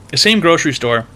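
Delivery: ordinary talk, nothing marked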